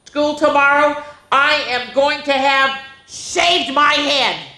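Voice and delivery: high, loud, slow scolding of copycats; a girl yelling at copycat classmates; angry yell. Acted. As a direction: shout it at the brainless copycats. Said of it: "Stephanie yelled".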